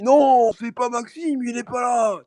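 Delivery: slow, high put-on old man; mock old man voice, very slow. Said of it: "en mode, je dis".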